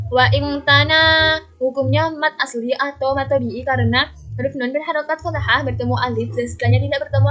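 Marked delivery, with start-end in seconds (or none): 0.1-1.4 slow, loud recitation with "tana" drawn out; "Wa in tana" chanted slowly, final vowel held; two-beat long vowel. Shown as a demonstration. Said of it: "panjang dua harokat".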